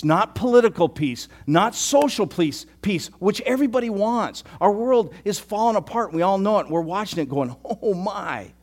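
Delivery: high, loud, impassioned sermon delivery; passionate preaching. Acted. Pastor to congregation on Jesus weeping, full-force preaching. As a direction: preach it with rising fervor.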